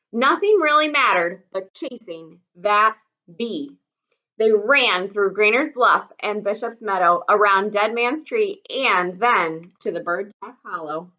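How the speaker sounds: plain reading voice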